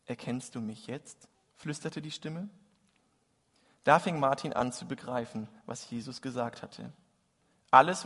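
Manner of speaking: lively storytelling, warm and vivid; reading a Christmas story aloud; story narration. A narrator's reading. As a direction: tell the tale to the congregation. Preacher reads Tolstoy story aloud.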